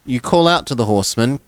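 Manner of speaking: normal talking voice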